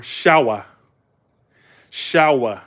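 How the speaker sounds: "shower" with no final R; New England "shower", R dropped; Northern pronunciation. Retold or imitated. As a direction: say "shower" as "shah-wuh". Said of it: "And they said".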